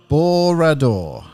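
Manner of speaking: "Borador" said slowly, syllable by syllable; Welsh phrase attempt. Shown as a demonstration. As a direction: spell out "Borador" carefully, drawn out. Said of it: "I'm gonna try to speak Welsh".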